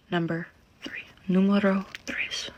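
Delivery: hushed, playful whisper. Real, not acted. Makeup vlogger counting off step number three, whispering.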